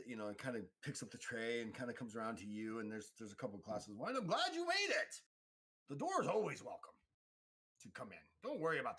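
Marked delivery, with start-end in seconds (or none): 4.2-9.0 very high, loud character greeting; showy high host voice; effusive welcoming host. Acted. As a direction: greet guests in a raised, high-pitched voice.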